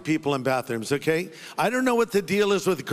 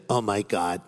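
low retold oh-no; stranger's dismay; 10 semitones lower than usual. Stranger learns it is his pastor, low mock dismay. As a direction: drop low as the caught man. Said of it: "He said".

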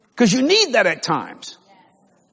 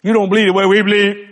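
mock scornful church member; 5 dB louder than usual. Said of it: "They're always sour".

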